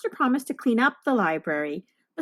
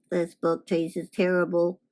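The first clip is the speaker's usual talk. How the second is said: a lower, disgusted monster grumble; 5 semitones lower than usual. Acted. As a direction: grumble like a monster with a bad snack. Monster head tasting a book.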